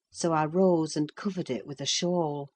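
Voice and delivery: usual speaking voice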